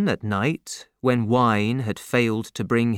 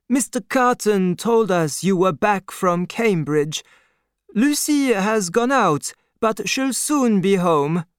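put-on higher-pitched, kindly old father; old doctor's voice; 7 semitones higher than usual. A narrator's reading. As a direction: raise the voice for the aging doctor. Doctor speaking.

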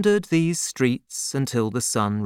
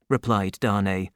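usual speaking voice

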